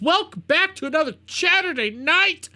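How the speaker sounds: high, rowdy welcome to the show; yelled show welcome. Real, not acted. Host opening Saturday night show, yelling. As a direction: yell the greeting like a fired-up host. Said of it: "I'm ranting and yelling".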